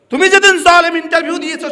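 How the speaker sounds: loud, high scolding cry; angry rebuke. Real, not acted. Preacher rebuking the imam who ruins prayer, angry shout. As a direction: thunder at the careless one.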